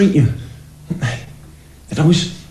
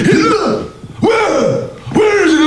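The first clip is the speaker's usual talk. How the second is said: very high mock shriek; alarmed grandad; 14 semitones higher, slower than usual. Grandad running in at grandma's cry, panicked shriek. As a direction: yelp it in comic panic. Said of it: "Grandad would run and go".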